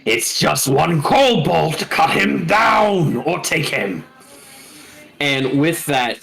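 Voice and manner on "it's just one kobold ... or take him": Draconic shouting voice. Acted. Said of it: "you do hear in Draconic a voice call out".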